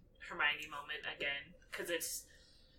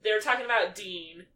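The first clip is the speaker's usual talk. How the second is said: ordinary talk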